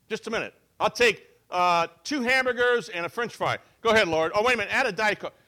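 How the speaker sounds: barking an order at the drive-thru speaker. Retold or imitated. Praying to God like ordering fast food, loud and hurried.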